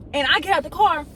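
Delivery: usual speaking voice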